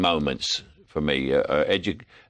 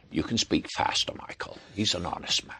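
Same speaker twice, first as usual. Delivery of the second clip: put-on hushed, breathy old director, much softer; John Huston impression; 7 dB quieter than usual. Director stops young actor mid-scene, hushed John Huston voice. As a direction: murmur it like a wry, weary director. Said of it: "give me a little bit more of John Huston".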